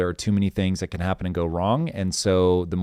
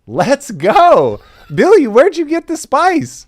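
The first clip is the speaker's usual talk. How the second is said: loud, very high hype shout; excited teasing; 11 dB louder, slower than usual. Real, not acted. Host hyping co-host's spiced pronunciation, excited teasing. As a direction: whoop it up at a friend's flair.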